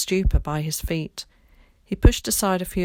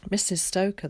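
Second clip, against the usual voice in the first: ordinary delivery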